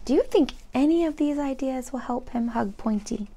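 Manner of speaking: plain reading voice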